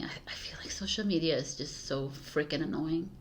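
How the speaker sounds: hushed, breathy complaint; whispered gripe. Real, not acted. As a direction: whisper it like a confession.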